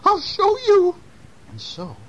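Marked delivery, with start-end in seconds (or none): 0.1-1.0 put-on very high old-lady voice; high-pitched storybook old woman. A narrator's reading.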